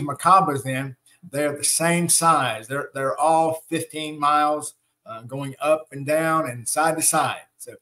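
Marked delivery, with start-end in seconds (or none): none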